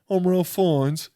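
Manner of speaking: hammed-up British actor introducing himself; silly mock British voice; Ralph Fiennes. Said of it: "no way he sounds like that".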